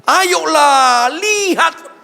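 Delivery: high, loud preacher's call; a pastor thundering at the crowd; full-force preaching. Acted. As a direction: fire it at the congregation.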